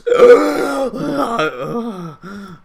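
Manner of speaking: loud, high gasp for air; hammed-up surfacing gasp; big gasp. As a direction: come up from the water gasping. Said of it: "and then he goes".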